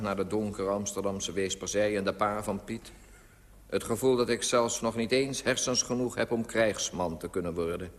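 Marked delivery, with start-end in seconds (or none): none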